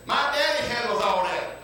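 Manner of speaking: ringing out the line to the congregation; proclaimed punchline. Acted. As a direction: declare it with preacher's fire. Preacher proclaims the story's punchline.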